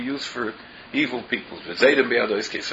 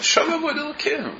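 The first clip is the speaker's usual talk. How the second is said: Shov flung out much higher, aghast; outraged high quote. Retold or imitated. Rabbi quoting the people's words in outraged disbelief. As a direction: sound scandalized at "Shov avoid Elokim". Stressing how terrible the words are.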